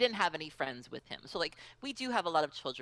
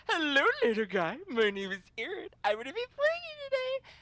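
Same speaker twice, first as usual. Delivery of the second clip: very high cutesy greeting; talking to a child; 13 semitones higher, 5 dB louder than usual. Retold or imitated. Player greeting cute young opponent, high cooing baby talk. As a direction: coo brightly down at a little kid. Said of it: "I went into it like".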